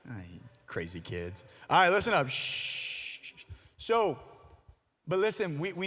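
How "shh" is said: unvoiced "shh" to hush the room; long shushing. Real, not acted. Speaker settling noisy kids to listen, shushing. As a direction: shush the crowd. Hushing the crowd.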